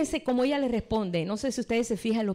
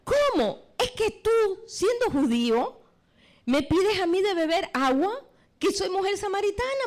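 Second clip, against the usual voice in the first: indignant exclamation; 9 semitones higher, 3 dB louder than usual. Acted. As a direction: cry it out in disbelief, pitch jumping up. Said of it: "samaritana exclamó".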